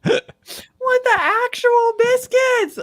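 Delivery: very high, stretched-out squeal of disbelief; incredulous high-pitched cry; shocked squeal. Real, not acted. Shocked laughing outburst.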